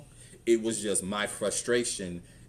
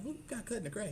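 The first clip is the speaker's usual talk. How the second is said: much higher, softer squeaky question; high mock surprise; 13 semitones higher, 10 dB quieter, faster than usual. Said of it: "I'm like".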